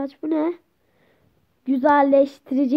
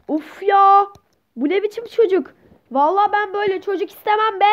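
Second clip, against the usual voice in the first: higher-pitched acted exasperation; exasperated; 5 semitones higher than usual. Parent refuses to accept such a child, exasperated. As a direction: complain indignantly, pitched up.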